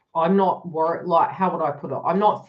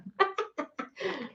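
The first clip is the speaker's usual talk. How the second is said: soft high laugh; 10 semitones higher, 9 dB quieter than usual. Real, not acted. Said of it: "I do great until that".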